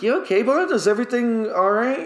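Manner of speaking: high, alarmed check-in on a client; worried concern. Retold or imitated. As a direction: ask fast and anxious if he's okay. Barber checks on crying client.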